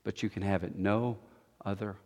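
low, soft, solemn and measured; hushed preaching. Real, not acted. Sermon closes in hushed gravity.

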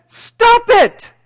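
high, loud blast of a command; curt therapist yelling at his patient; sudden shout. Acted. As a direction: bark it at her out of nowhere. Therapist repeats his two-word cure.

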